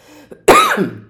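loud sharp dry cough. Real, not acted. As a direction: cough hard once. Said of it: "I have a cough".

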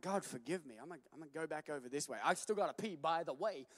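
quiet, quick hushed mutter to himself. Retold or imitated. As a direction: mutter it under your breath, hurrying. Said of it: "I'm like".

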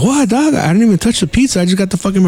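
high protesting voice; mock innocent protest. Caught with the pepperoni, protesting innocence to Harry, pitched high. Defending himself to angry Harry.

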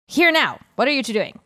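a constable calling out sharply; stern constable shout. Acted. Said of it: "the sharp voice".

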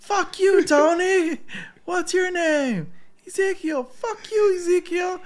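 high, loud, wailing curses; mock angry yelling. Friend acts out the viral video guy, loud high angry yell. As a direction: rant like a furious man on a roof.

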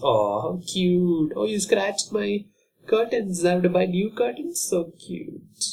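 high, slow cooing over the cat; doting cat owner. Retold or imitated. Cat owner cooing at the cat scratching the curtains. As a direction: gush like a besotted pet owner. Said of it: "They just".

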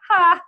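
one loud, high "ha"; single big laugh. Shown as a demonstration. Host demonstrates how she laughs, one loud high ha. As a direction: burst out one short laugh syllable. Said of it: "I'll just give something like a".

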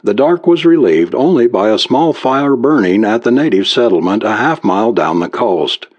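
ordinary delivery

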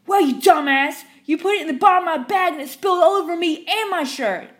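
furious customer shrieking; screaming. Retold or imitated. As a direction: scream it waving the cup. Rabid customer yelling.

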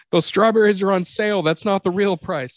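hammed-up bright Whole Foods voice; mock store shopper. Mock shoppers consoling him, laughing.

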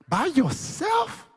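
high, slow, astonished cry; amazed shout. Real, not acted. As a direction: stretch it out in disbelief, way up high. Amazed at worshiping alone.

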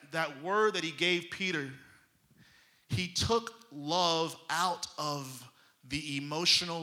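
ordinary talk

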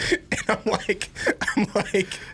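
laughing through the words; can't stop giggling while talking. Real, not acted. Laughing at his own story.

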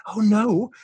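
high, loud oh no; surprised realization. Retold or imitated. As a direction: squeal a sudden realization. Wife realizing misunderstanding.

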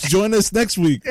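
high, loud cartoon announcer voice; cartoon narrator. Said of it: "Rocky and Bullwinkle".

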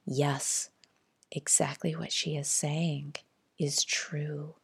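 very soft, hushed inner voice; quiet inner knowing. Acted. Another part of her agreeing with the healer, hushed. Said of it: "I could feel it was saying".

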